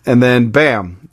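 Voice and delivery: normal talking voice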